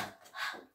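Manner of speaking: "h, h" as a soft unvoiced puff of breath; letter sound. Shown as a demonstration. Teacher modelling the h sound for the kids, a breathy puff. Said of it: "In with the h".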